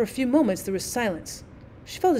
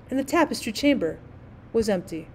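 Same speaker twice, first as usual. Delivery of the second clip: usual speaking voice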